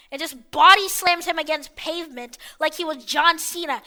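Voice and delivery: ordinary talk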